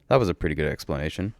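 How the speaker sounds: usual speaking voice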